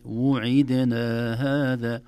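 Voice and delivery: وعدنا langsam als ′wuʕidna′, jeder Vokal klar; korrekte Aussprache. Shown as a demonstration. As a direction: dehne وعدنا und trenne Damma und Kasra. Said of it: "تأتي بالتدريب".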